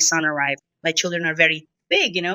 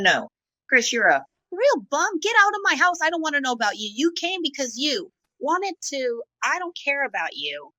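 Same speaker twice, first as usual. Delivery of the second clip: higher-pitched retold telling-off; mock angry rejection; 6 semitones higher than usual. Woman acting out kicking her boyfriend out, scornful rejection. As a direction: replay throwing him out, pitch climbing without shouting. Acts out throwing him out.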